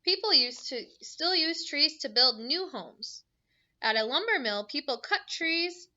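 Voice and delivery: bright storybook read-aloud; reading to children. Acted. Teacher reading a book to her class, bright and lively.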